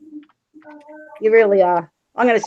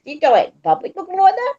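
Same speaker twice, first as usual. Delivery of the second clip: incredulous disbelief; 10 semitones higher, faster than usual. Retold or imitated. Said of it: "I've looked at some men and gone".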